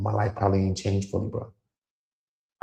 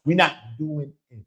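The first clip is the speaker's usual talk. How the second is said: firm refusal; 7 semitones higher than usual. Real, not acted. Refusing exploitation.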